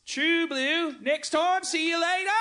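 high, slow hammed-up Australian accent; mock Australian accent. [name] in the Australian dialogue, hammed-up high Australian accent. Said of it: "have a stroke".

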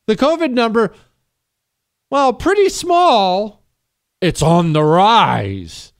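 high, slow, hammed-up newsreader drawl; mocking the reporter. Host mocking a news anchor's report, slow, high and exaggerated. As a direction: parrot the anchor's line with slow mock gravity. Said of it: "what'd she say?".

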